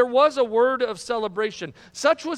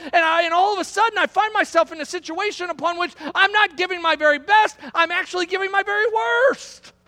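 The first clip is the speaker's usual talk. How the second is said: raised, high-pitched sermon delivery; impassioned preaching; 8 semitones higher, 5 dB louder than usual. Real, not acted. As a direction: preach it with mounting force up to the last word. Preaches at rising full force.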